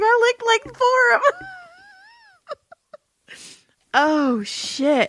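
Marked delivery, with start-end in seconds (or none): 0.0-1.5 laughing mock mad grandpa. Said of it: "He was so mad".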